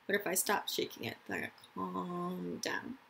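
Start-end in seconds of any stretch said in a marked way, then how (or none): none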